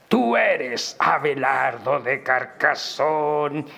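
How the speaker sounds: put-on slow accusing old monk cry; very old man. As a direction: drag each word out like an ancient monk. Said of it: "comenzó a gritar".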